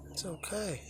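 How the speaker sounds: high, soft soothing voice; gentle reassurance. Real, not acted. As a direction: reassure a shy animal very quietly. Reassuring a shy cow.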